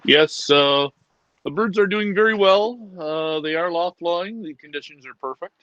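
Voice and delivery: low put-on voice, mock formal report. Reporting to the European viewers, low put-on voice. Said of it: "the pee-pee dance when you talk like that".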